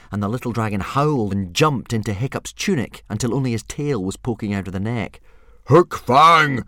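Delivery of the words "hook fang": loud booming call; bellow. A narrator's reading. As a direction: bellow the dragon's name. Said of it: "bellowed Stoic".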